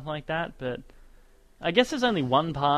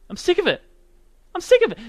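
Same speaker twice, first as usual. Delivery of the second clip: high-pitched, loud exasperation; loud, fed-up high yelp; exasperated outburst; 9 dB louder, faster than usual. Real, not acted. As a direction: burst out in a fed-up squeal. Exasperated rant about gibberish voices.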